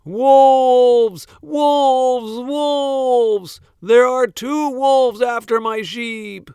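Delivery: high, loud alarm shout; yelling. A narrator's reading. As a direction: scream it across town for help. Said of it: "this time yelling".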